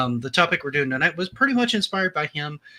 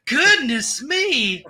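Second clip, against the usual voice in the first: mock Southern belle; 12 semitones higher, 6 dB louder, much slower than usual. Southern belle joke continues.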